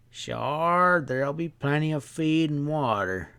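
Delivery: lightly voiced sly country stableman; a hint of a sly drawling stableman sizing them up.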